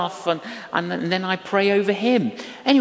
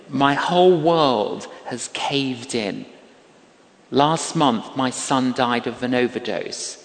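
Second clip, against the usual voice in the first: grieving; 6 semitones lower than usual. Retold or imitated. Voicing grieving mother's confession.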